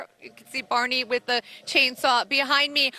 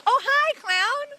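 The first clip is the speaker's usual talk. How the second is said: nervous greeting; 9 semitones higher, much slower than usual. Real, not acted. Greeting a scary clown actor.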